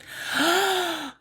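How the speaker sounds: big, drawn-out gasp; exaggerated, offended intake of breath; appalled gasp. Reacting to being told "I'll let you go", appalled gasp. Horrified at a hated farewell phrase.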